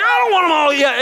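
high, loud hammed-up protest; mock ranting. Venting about the other church, mock rant. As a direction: squeal it out like a comic tantrum. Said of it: "I would rant and rail and vent".

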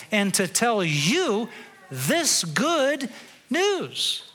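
indignant angel. Acted. Gabriel scolding Zechariah, slow and emphatic. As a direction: lean on every word like a miffed archangel. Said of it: "I think he said it in that tone".

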